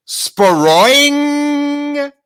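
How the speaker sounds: high, loud, drawn-out boing; vocal sound effect of a spring snapping; springing trap noise. Retold or imitated. Chewie sets off the trap, loud springing noise.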